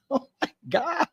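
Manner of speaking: high mock wail breaking into laughter; imitated yell. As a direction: mimic his high cry while laughing. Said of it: "It sounds the same every time".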